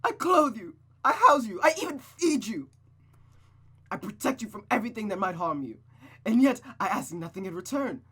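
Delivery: very loud, high, raging yell; furious tirade. Acted. Mother raging at Rosa about everything she gives, furious yelling. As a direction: roar every accusation at her.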